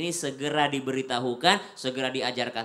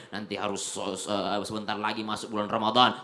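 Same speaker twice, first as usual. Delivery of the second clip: ordinary talk, nothing marked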